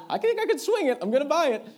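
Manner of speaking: very high, loud squeal of resolve; excited decision. Retold or imitated. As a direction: blurt it loudly in a high voice. Acted eager decision to buy ring.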